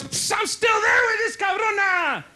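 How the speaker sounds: high, drawn-out shout; mock war-weary holler from a veteran; exasperated soldier uncle. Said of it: "My other tío, he's a retired soldier".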